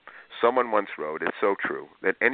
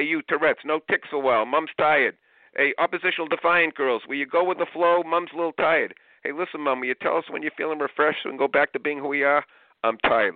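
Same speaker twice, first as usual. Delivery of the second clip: mock kid take-over; 7 semitones higher, 3 dB louder than usual. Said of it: "the oldest kid in your house".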